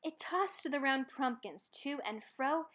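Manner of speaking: ordinary delivery, nothing marked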